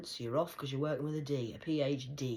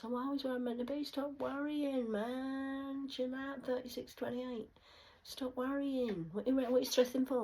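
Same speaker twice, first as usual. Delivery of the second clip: sing-song taunt, high and slowed on "Stop worrying"; high mocking voice; 12 semitones higher, 4 dB louder than usual. Ranting alone, taunting imagined listeners in a high mock voice. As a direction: tease them in a high mock voice.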